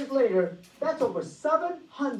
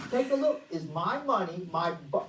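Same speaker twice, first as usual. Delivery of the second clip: ordinary talk, nothing marked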